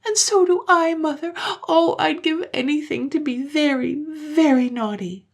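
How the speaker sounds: put-on high, plaintive child wail; acted little-girl misery; sad crying. A narrator's reading. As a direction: lament tearfully, slowing at the end. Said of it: "cried sadly".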